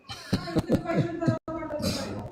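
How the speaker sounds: a high, breathless cackle; high mocking laugh. Real, not acted. Speaker laughing at crying people, high mocking cackle. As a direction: laugh high and soft at their tears.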